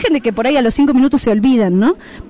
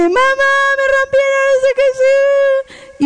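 put-on very high, drawn-out kid wail; whining child; 12 semitones higher, slower than usual. Older child complaining to mom about broken Lego, whining wail. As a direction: imitate a tattling child's long whine. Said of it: "y viene".

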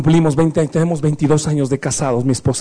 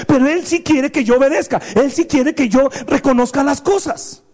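impassioned preaching; 8 semitones higher than usual. Acted. Preaching, high.

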